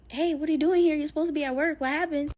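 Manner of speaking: high, loud, surprised questions; startled questioning. Retold or imitated. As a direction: act out being caught off guard. Startled by boyfriend at the door.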